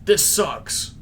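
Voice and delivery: frustrated. Retold or imitated. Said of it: "He's like".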